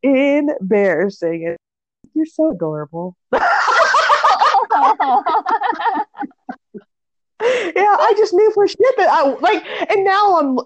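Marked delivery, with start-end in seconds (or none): 3.3-6.2 long, high burst of real laughter; big high laugh. Real, not acted. Friends amused by the story, big high laugh.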